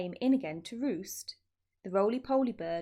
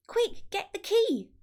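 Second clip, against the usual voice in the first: loud, higher urgent call; 11 semitones higher, 5 dB louder than usual. A narrator's reading. Mugglewump calls up to the Roly-Poly Bird, loud urgent call. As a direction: call up to the roof in a hurry. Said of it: "Mugglewump called out".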